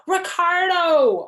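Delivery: loud, high, drawn-out holler. A narrator's reading. Girl hollering after her boyfriend, loud. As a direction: call his name across the playground. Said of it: "I hollered real loud".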